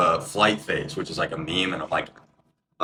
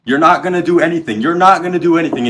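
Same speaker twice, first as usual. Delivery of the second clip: loud, high, heated shouting; retold screaming; 8 semitones higher, 9 dB louder than usual. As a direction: yell it as a furious standoff. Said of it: "and I'm screaming".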